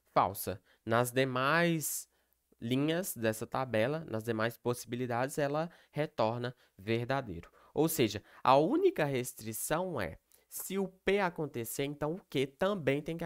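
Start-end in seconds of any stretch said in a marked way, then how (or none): none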